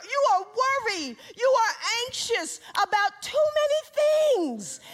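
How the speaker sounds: high, loud, ringing sermon climax; preaching at full force. Acted. Preacher crying out Jesus' words to Martha, loud and high. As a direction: preach it out with rising fervor.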